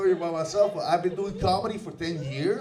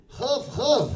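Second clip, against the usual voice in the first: higher-pitched mock bark with a huff; Hebrew dog bark; 6 semitones higher, 3 dB louder than usual. Showing how dogs bark in Hebrew, mock bark. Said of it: "how the dogs bark in Hebrew".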